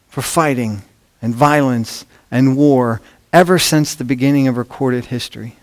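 normal talking voice

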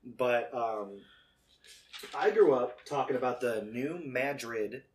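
normal talking voice

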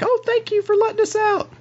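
high falsetto thanks; squeaky grateful character voice; Clarice, relieved. Acted. Game master plays Clarice thanking rescuer.

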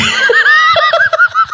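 a high-pitched squeal of laughter. Real, not acted. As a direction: squeal with laughter at your own joke. Laughs at own dad joke.

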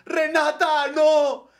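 high, loud dramatic movie cry; movie-style cry. Retold or imitated. Heartbroken guy crying out her name like in the movies. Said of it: "como en las películas, así como".

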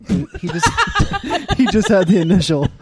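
laughing through the words. Real, not acted. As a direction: giggle while you say it. Laughing while telling.

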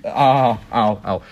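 loud "ah, ow, ow" of real pain. Real, not acted. Sore host breaking off the sign-off, pained ows. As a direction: cry out as the ache hits. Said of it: "hear the pain in my voice".

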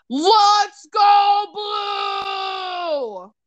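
high, very drawn-out holler; cheering shout. Real, not acted. Player backing blue team, long high cheering shout.

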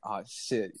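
quiet retold dread under the breath; retold panic. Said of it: "like, panicking, like".

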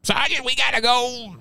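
very high, strained mock yell; hammed-up military wake-up call, held back in volume; sergeant's mock yell. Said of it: "I'm getting yelled at from my bunk".

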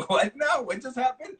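way up high, imitating a stunned receptionist; mock baffled staff. Retelling how the Sony front desk reacted, mock high disbelief. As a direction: squeak it out like the front desk can't believe it.

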